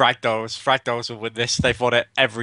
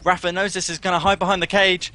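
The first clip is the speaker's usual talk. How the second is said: higher-pitched running match commentary; tense play-by-play; 5 semitones higher than usual. Real, not acted. Commentator follows Clock dodging and jumping, tense high play-by-play. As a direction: follow each move with mounting tension.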